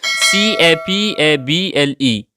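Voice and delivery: slow letter-by-letter spelling; spelling aloud for learners; spelled out. Shown as a demonstration. Spelling out the word.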